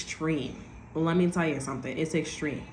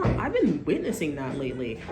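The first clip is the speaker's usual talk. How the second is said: ordinary delivery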